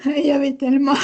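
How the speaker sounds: real tearful speech; crying. Real, not acted. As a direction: try to speak through tears. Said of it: "vous pouvez pleurer, pleurez".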